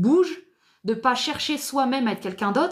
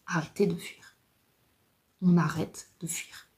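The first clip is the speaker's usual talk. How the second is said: whisper; 4 semitones lower, 4 dB quieter than usual. Shown as a demonstration. Urging listeners to stop fleeing.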